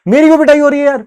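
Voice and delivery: high, loud aggrieved complaint; hammed-up wronged wail; indignant protest. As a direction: protest the injustice at full voice. Same protest repeated as punchline.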